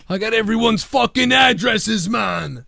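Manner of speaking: high shouted parody voice; Young Scott parody. Retold or imitated. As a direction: yell it like an excited troll. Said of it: "that's Young Scott".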